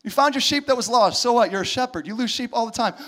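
ordinary talk

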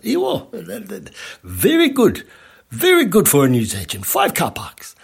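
mock Indian accent, starting high and settling; imitated Indian accent. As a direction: imitate the Indian owner proudly repeating himself. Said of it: "He was an Indian fella".

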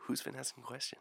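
quiet conspiratorial question; hushed. Acted. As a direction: drop to a hush. Whispers back in kind.

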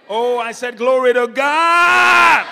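loud, high preacher's shout, last word held; rousing shout. Real, not acted. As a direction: shout it out to rouse the congregation. Repeats call, demanding louder response.

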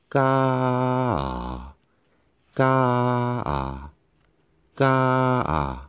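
ga on separate steps, slow; ga broken apart step by step; tone split into steps. Shown as a demonstration. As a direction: break ga into three pitch steps. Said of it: "separate the tone".

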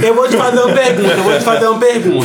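high and fast, hyped up; excited fast question. Pretend. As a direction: announce the question at speed. Fast high mock announcement.

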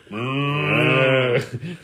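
long drawn-out mooing; imitated cow's moo. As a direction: moo like a cow. Punchline of cows go joke.

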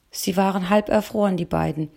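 usual speaking voice

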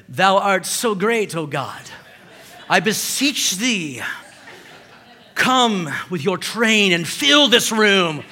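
slow, grand mock King James prayer; hammed-up old-style prayer; mock eloquent prayer. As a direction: lay on the lofty thee and thou, slowly. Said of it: "you say it in an eloquent way".

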